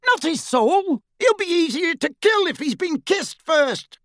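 high, sustained Death Eater yell; shouted exchange. A narrator's reading. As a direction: holler it across the street to accomplices. Death Eaters calling to each other.

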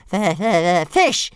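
high, loud stuttering dragon demand; hissing little dragon. A narrator's reading. Hungry dragon demands fish from his master, high stuttering hiss. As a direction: stammer it out as a small, pushy dragon. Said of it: "hissed Toothless".

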